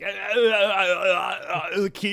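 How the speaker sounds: mock stammer, high and loud; stammering believer. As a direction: imitate a flustered believer fumbling for words. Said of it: "that's exactly how they'd say it".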